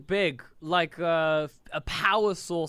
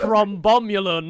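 silly made-up name; 3 semitones higher, 7 dB louder, slower than usual. Pretend. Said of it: "the dumbest names".

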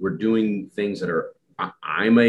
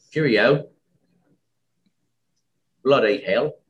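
higher, louder mock British accent; hammed-up British accent; 5 semitones higher, 6 dB louder than usual. Shown as a demonstration. Host showing off to the English guest, hammed-up British accent. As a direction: ham up a British toff. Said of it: "my British accent went Australian".

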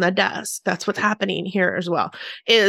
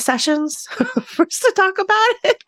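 laughing through it, pitched up; 9 semitones higher than usual. Retold or imitated. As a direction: giggle the words out. Joking reply, laughing.

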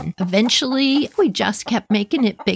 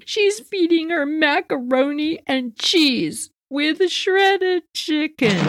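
higher, slow and upset on shredded chicken; upset caller; 8 semitones higher, slower than usual. Retold or imitated. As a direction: drag out the shocking detail. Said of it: "This is the part that's upsetting".

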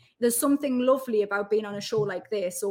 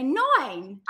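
drawn-out Essex "nine", like "noin"; 4 semitones higher, 3 dB louder than usual. Shown as a demonstration. Geordie showing how Essex says it, Essex "noin". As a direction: stretch "nine" wide and higher in Essex style. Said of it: "but in Essex, they say".

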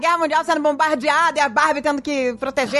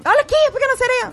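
coaxing offer; 11 semitones higher, faster than usual. Retold or imitated. Mother retells offering themes.

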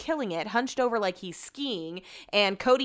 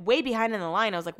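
normal talking voice

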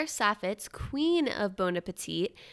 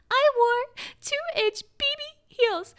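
much higher, louder, slow put-on socialite voice; Alexis Nyers voicemail; 7 dB louder, slower than usual. Host imitating the famous voicemail, high, loud and slow. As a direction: mimic the indignant voicemail, high and stretched. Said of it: "the iconic voicemail of".

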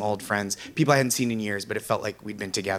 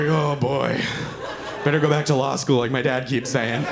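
mock waiter; 4 dB louder than usual. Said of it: "She's like".

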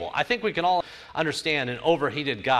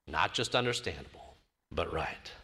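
muttered insistence; 8 semitones lower, 10 dB quieter than usual. Retold or imitated. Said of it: "he repeatedly said".

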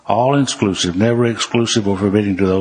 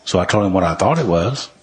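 ordinary talk, nothing marked

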